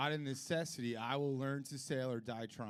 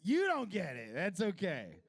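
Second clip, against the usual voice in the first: dismissive comeback; 5 semitones higher, 5 dB louder than usual. Retold or imitated. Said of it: "I'm like".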